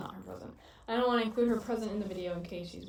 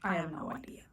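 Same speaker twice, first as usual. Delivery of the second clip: whispering; 5 semitones lower, 5 dB quieter than usual. Real, not acted. Quiet aside while out.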